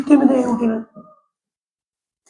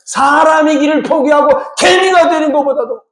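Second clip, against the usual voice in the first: normal talking voice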